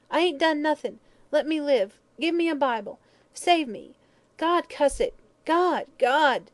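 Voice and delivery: high, loud, terrified wail; whimpering plea. A narrator's reading. Dying hag begging God, wailing in terror. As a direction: plead for life in a high wail.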